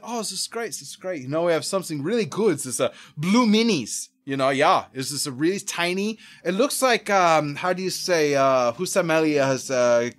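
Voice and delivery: put-on Dutch-accented salesman groping for words. Seller describing tiny mushrooms to tourist, put-on Dutch accent. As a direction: imitate an Amsterdam seller's halting accent. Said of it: "I'll dig the accent".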